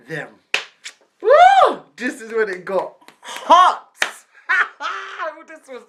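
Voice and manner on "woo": high, loud woo; excited whoop. Real, not acted. Storyteller as it gets hot, excited whoop.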